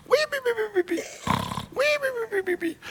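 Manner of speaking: high, soft whistling snore; comic snoozing whistle. Pretend. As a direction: snore like a sleeping king in a cave. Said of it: "He's just snoozing".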